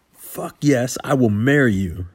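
loud, high, thrilled acceptance; elated shout. Acted. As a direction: shout it with joy. Accepting proposal overjoyed.

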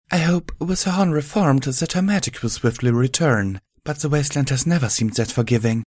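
gentle, polished pony voice; Velvet voice. A narrator's reading. Velvet's voice in audiobook.

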